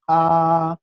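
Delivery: "A" held high and level; loud, drawn-out level "A"; first tone. Shown as a demonstration. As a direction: sustain "A" on one high, unwavering note. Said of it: "Otra vez desde el inicio".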